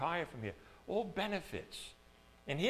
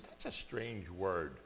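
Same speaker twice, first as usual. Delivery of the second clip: thinking aloud; musing to himself; 8 semitones lower, 9 dB quieter than usual. Retold or imitated. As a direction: puzzle over the word quietly. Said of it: "And I says".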